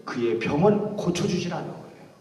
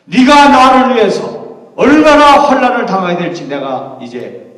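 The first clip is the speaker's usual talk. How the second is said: Jesus calling out; 5 semitones higher, 11 dB louder than usual. Acted. Jesus calling Saul from the light, loud and commanding. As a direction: boom down from heaven, drawing out the name.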